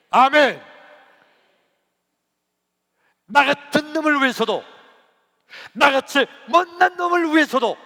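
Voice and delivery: loud, high, impassioned preacher; full-force preaching. Real, not acted. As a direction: thunder it out in bursts with pauses.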